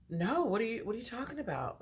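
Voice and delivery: high, soft retold bafflement; feigned innocence. Answering a suspicious date, high-pitched feigned innocence.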